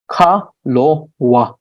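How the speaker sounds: "kalowa" slowly split as ka, lo, wa; syllable by syllable. Shown as a demonstration. Teacher breaking a word down for learners, slow separated "kalowa". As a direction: say "kalowa" at half speed, pausing between syllables.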